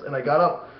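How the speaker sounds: ordinary talk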